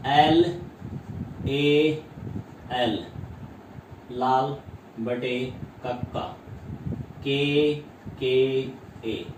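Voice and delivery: each letter set apart with pauses; spelled out mnemonic. Shown as a demonstration. Teacher spelling the mnemonic for students, slow and deliberate. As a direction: drill it letter by letter for the class. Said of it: "ये आपको याद रखना है".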